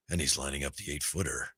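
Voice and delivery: imitating a hushed golf broadcaster; hushed golf commentary. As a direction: murmur it like a golf commentator by the green.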